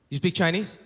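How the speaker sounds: soft, low-key question to a friend; imitating a friend asking discreetly. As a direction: ask on the quiet. Friend's sly first ask.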